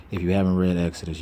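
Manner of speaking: normal talking voice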